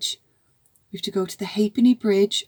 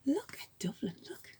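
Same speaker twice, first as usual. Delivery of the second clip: whisper; 15 dB quieter, much faster than usual. Real, not acted. Pointing out picture to child.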